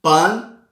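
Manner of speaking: pan with a clear open a, said out loud; pan, the open Spanish a. Shown as a demonstration. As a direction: give pan a bright open a. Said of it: "Exemplo, em espanhol".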